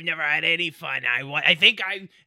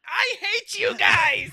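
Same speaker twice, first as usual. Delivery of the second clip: mock crying; 11 semitones higher, slower than usual. Acted sobbing farewell.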